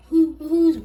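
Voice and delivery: high loud startled question; surprised shout. Acted. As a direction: yelp it out suspiciously. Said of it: "asked Mister Snealy".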